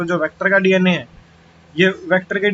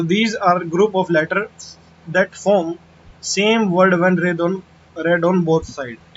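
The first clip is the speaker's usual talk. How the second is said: slow dictation; much slower than usual. Shown as a demonstration. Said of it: "teacher reading definition slowly".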